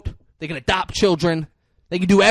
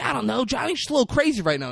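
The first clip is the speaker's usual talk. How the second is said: lightly acted, higher and quick; defensive retelling; 7 semitones higher, faster than usual. Frankie explaining Johnny to Chucky, higher mock-defensive voice. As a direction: excuse it in a raised, hurried voice.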